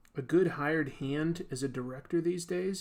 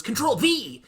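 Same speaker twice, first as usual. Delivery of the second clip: villain catchphrase; 8 semitones higher, 8 dB louder than usual. Acted. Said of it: "And then he goes".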